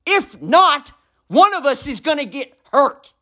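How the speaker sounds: furious, high yelling at the neighbor below; screamed rant. Real, not acted. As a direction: scream every word so the neighbor hears. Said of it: "this message was screamed in my apartment".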